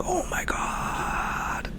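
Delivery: slow breathless whisper of disbelief; awed whisper. Retold or imitated. Remembering the big call, a slow awed whisper. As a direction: barely breathe it out, stunned.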